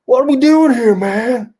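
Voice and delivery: high, loud put-on complaint; Sean. Sean griping to his old buddy, high mock complaint. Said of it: "Sean has a Brooklyn accent".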